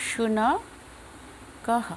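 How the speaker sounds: শুনকহ said very slowly, clear palatal শ and breathy final হ; slow careful Sanskrit. Shown as a demonstration. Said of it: "তালব্য শ দিয়ে".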